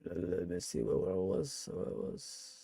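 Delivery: a whispered aside, almost no sound; whisper to self. Real, not acted. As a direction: murmur it under your breath while looking. Searching for his place again.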